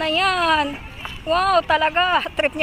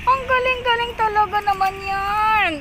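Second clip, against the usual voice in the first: praising a pet; 5 semitones higher than usual. Real, not acted. Owner praises the dog's cleverness, high gushing tone. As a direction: gush over a clever dog, pitched up.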